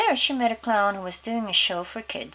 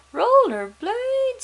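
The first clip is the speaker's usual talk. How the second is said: much higher, slow stretched disbelief; shocked disbelief; 8 dB louder, much slower than usual. Acted. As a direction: drag out the words in horror. Father shocked at rollerblades.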